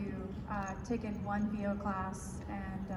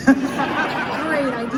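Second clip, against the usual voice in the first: laughing; 5 semitones higher, 14 dB louder, much slower than usual. Real, not acted. Laughs at the hentai joke.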